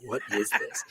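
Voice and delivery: whispered in disbelief, slow; awed whisper. Retold or imitated. Whispering to listeners about the hut video, awed. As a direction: hush it like you can't believe your eyes. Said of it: "I'm like".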